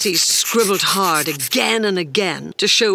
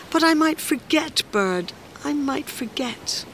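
high, anxious voice fading softer; worried small creature; 8 semitones higher, 3 dB quieter than usual. Acted. Small Creature frets to Fish about forgetting, high fading worry. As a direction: pitch it high, then let it shrink away.

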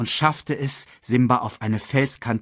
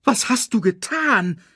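barking at him; 12 semitones higher, 3 dB louder than usual. A narrator's reading. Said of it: "herrschte Scar ihn an".

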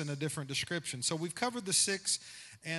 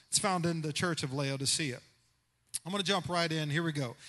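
ordinary delivery

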